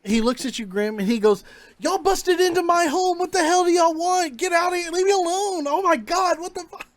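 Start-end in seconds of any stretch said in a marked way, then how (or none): 1.8-6.9 high, loud nonstop panicked protest; freaked-out rattle. Acted. Homeowner to the intruders who burst in, freaked out. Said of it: "rattles off, like, nonstop, being freaked out".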